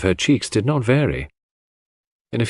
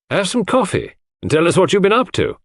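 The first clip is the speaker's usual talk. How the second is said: higher hearty invitation; hearty host; 10 semitones higher than usual. A narrator's reading. John invites the muddy doctor in, jovial. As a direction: jovially offer him coffee.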